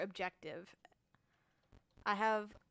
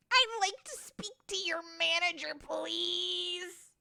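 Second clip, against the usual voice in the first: higher entitled Karen voice; 8 semitones higher, slower than usual. Retold or imitated. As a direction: demand the manager, entitled. Karen asks for manager.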